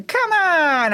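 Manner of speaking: cheer-yell at the screen like it's a sports game. Retold or imitated. Film fan yelling at the TV over the family, laughing mock yell. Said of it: "I yelled at the T V like I was watching a sports game".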